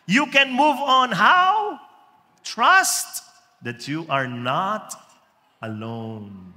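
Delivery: loud, high ringing pulpit cry; full-force preaching. Acted. Preacher proclaiming hope to the congregation. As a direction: lift it up and proclaim it.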